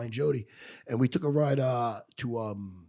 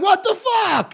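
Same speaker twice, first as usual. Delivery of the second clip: much higher, louder indignant what the fuck; outraged disbelief; 8 dB louder, much slower than usual. Retold or imitated. Retelling being turned down by the recruiter, higher and louder in outrage. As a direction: blurt it out in disbelief.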